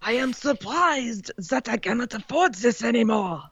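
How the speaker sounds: hammed-up French chef accent. French put-on voice after Mon Dieu.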